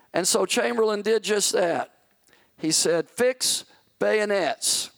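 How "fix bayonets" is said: firm, slow command; measured first call to fix bayonets; first order. Retold or imitated. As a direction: give the order steadily, holding back. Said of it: "he yelled it the second time".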